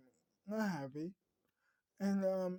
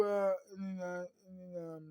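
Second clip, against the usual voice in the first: plain reading voice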